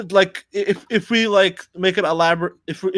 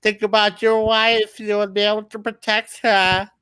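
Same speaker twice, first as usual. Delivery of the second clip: sly, higher evil scientist voice; 4 semitones higher than usual. Acted. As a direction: coax like a scheming villain. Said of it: "evil scientist voice".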